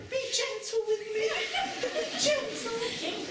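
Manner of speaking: very high falsetto mock-female plea; pleading lover. Shown as a demonstration. Boy imitating a girl yielding, high mock pleading. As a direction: plead in a hammed-up, high girlish voice. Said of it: "her voice may appear somewhat husky, as she pleads".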